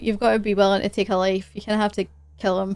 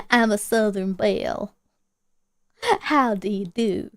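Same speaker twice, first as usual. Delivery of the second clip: put-on butcher a genteel Southern belle accent; bad Southern belle accent. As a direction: playfully fake Southern belle. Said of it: "I can't do accents".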